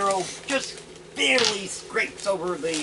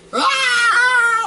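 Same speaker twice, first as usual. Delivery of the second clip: very high, loud war scream; battle yell; 8 dB louder than usual. Acted. Cleric charging the kobold with a high scream. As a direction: let out a piercing charge cry. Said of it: "the cleric goes like".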